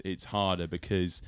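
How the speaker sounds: ordinary talk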